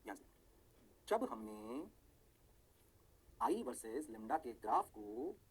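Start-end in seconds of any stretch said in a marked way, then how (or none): none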